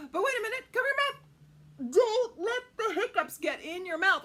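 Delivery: loud, high alarm; playful alarm, laughing; urgent warning. Acted. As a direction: warn the kids in a hurry.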